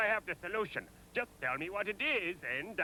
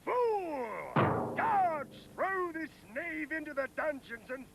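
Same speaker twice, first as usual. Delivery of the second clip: furious king; 6 semitones higher, slower than usual. Acted. King to guards, furiously ordering. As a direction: shout the orders.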